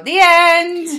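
big, loud, drawn-out finale; slow and loud, like closing a show; theatrical slow loud sign-off; mock grand finish. Real, not acted. Punchline to self-deprecating story.

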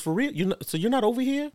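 high-pitched disbelieving imitation; mock weepy girlfriend. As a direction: question him in a put-on high, tearful voice. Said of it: "She's crying".

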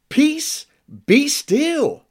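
high-pitched command to the storm; acted rebuke, pitched well up; mock rebuke. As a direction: hold the words apart, commanding high. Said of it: "he rebuked the wind".

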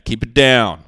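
sudden loud bark at the neighbor; mock yell. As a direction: shout it down the hall. Scolding loud neighbor Diane.

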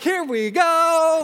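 high, loud, slow drawn-out sigh of resignation; bracing for the worst; resigned here we go. Retold or imitated. Leading awkward parade to the Jeep.

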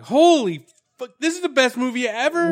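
loud, thrilled exclamation; excited. Retold or imitated. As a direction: blurt it out with delight. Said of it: "I was like".

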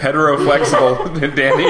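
laughing echo of the term; laughing repeat. Real, not acted. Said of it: "That was perfect".

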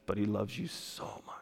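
soft tender whisper. Real, not acted. Preacher tells congregation God loves them, whispering. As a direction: lean in and whisper it.

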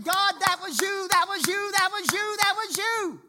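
loud, high repeated cry of praise. Retold or imitated. Man praising God after keeping his job, loud. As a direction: shout your thanks to God. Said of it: "I started praising God".

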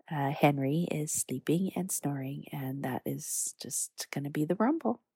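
soft whisper so the pug sleeps on. Real, not acted. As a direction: hush it for the sleeping dog. Pug sleeping beside her.

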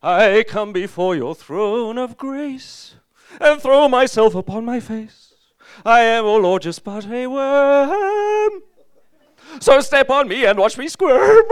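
loud, high, slow pompous grovel; milk the self-abasing verse; mock groveling hymn. A worshipper reciting a groveling verse, loud and slow. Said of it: "pray like this".

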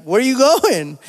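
playfully bewildered dad, pitch lifted; retold puzzled shout-after; mock incredulous. Dad chasing crying toddler, retold.

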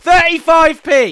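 loud, fed-up outburst; exasperated shout. Real, not acted. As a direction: yell it in exasperation. Repeats the price shouting.

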